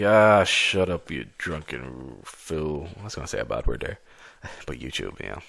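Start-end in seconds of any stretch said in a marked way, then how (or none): 0.0-2.9 loud, slow scoffing bark; gruff drunk retort. Acted. Tavern guy telling his drunk friend to shut up, loud slow scoff. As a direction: drag it out like shoving off a drunk mate. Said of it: "And the guy's like".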